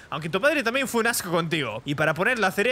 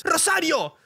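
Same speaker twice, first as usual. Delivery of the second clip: higher, calling across the house; 7 semitones higher, slower than usual. Acted. Boss yelling for his maid to come. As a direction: summon her from another room.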